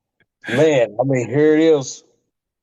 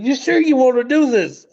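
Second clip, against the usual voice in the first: pitched way up, anxious and pleading; hammed-up worried question, much higher; mimicking a nervous Pat; 11 semitones higher than usual. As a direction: ask it like you're sure this will end badly. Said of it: "He said".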